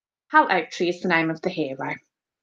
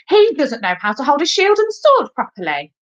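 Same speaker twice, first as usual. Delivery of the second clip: high-pitched list of faults; high, emphatic child critic; critical schoolboy; 7 semitones higher, 4 dB louder than usual. A narrator's reading. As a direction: complain insistently as an outspoken pupil. Ruskin goes on criticising.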